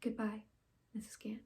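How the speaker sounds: soft, halting stage farewell; dramatic monologue. Acted. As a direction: take leave quietly, with long silences. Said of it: "I'll be doing a monologue".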